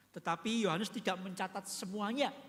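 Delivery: ordinary delivery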